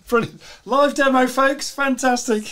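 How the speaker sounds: high, loud delighted cheer; thrilled by the live demo; excited. Real, not acted. Host reacts to live demo.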